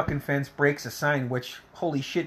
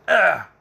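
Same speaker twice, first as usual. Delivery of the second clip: short, loud grunt of someone hit; sharp imitated "ugh" on impact; gut-punch grunt; 4 semitones higher, 8 dB louder than usual.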